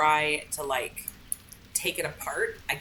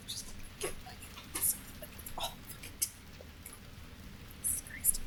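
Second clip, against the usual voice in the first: sigh, then a slow exasperated whisper; 14 dB quieter, slower than usual. Real, not acted. Gamer sighing and whispering curses at a stuck puzzle. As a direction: let the frustration leak out in a whisper.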